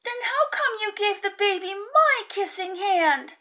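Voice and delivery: put-on high, loud child's voice; little raccoon voice. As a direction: press her with a hurt question. Child pressing his mother.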